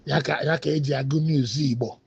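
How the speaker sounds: usual speaking voice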